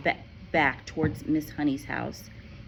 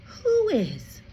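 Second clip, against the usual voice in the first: ordinary delivery, nothing marked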